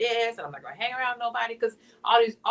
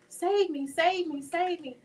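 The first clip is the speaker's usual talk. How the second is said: higher, repeated pleading prayer; desperate prayer; 7 semitones higher, slower than usual. Retold or imitated. Praying to be saved from hell, high pleading. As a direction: pray for rescue, voice lifted.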